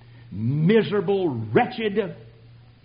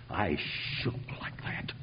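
whisper; 10 semitones lower, 13 dB quieter than usual. Real, not acted. Whispered recollection of shaking.

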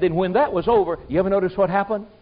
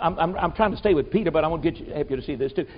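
ordinary delivery

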